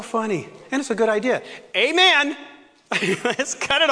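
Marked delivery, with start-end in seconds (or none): 1.8-2.4 high, loud amen to cut it short; jokey loud amen. Pretend. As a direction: punch it out as a joke. Joking congregational amen.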